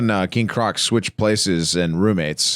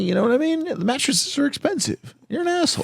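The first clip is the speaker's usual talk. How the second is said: much higher, softer mocking voice; mock scolding; 3 dB quieter than usual. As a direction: tease him in a high squeaky put-on voice.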